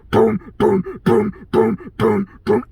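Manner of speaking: loud, punchy booming beat; imitated bass thump. Complaining about neighbor's music, loud bass imitation. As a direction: pound out each boom like a subwoofer. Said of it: "bass system that goes".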